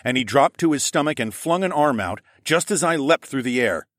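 ordinary delivery

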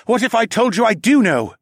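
high, loud challenge; defiant falcon man. A narrator's reading. Falcon man defying the wolf leader, high and loud.

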